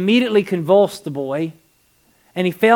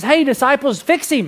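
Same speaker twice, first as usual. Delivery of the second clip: higher, louder mock demand to the helpers; 8 semitones higher, 4 dB louder than usual. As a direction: act out a dad demanding a fix. Acting the father demanding help.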